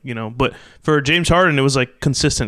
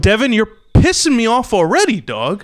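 exasperated; 7 semitones higher than usual. Real, not acted. Teasing exasperation at contradicting co-host.

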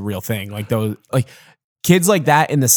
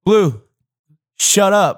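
higher, louder snap at a singing teammate; imitating Julius cutting off Blue's singing; mock snap; 8 semitones higher, 4 dB louder, much slower than usual. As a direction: tell him to shut up, half-joking.

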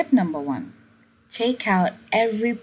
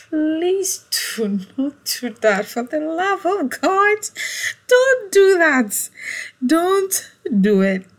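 much higher and louder, pleading through a laugh; laughing plea; 11 semitones higher, 5 dB louder than usual. Real, not acted. Begging viewers through laughter, high and loud. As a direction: beg them, laughing, to stop.

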